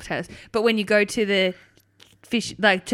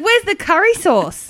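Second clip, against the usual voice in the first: high, loud, drawn-out indignant demand; retold angry outburst; 10 semitones higher, 7 dB louder, slower than usual. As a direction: imitate an outraged diner, slowly. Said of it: "he cracked the shit".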